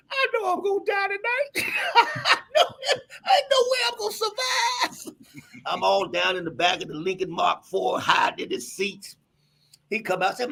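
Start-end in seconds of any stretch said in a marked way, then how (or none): 0.1-5.2 high, shouted, laughing fear; high frightened child voice through laughter; laughing mock terror. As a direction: play the terrified kid, half laughing. Scared child in the car.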